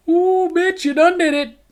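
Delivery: high, sassy "ooh" aimed at her; sassy inner reaction. Retold or imitated. Diner to himself about the waitress, sassy and high. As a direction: let her have it with a sassy pitch jump.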